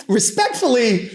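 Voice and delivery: high, drawn-out courtroom politeness; mock-respectful challenge. Lawyer disputing the witness's average-size claim, mock-respectful. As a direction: stretch it out high, with exaggerated deference.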